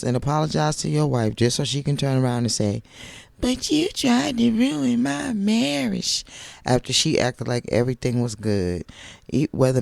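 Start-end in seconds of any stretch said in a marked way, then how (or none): 3.4-6.2 high, slow mocking whine; mocking imitation. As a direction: drag it out in a high whiny voice. Imitating Tisha's accusation.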